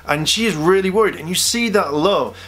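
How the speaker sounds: normal talking voice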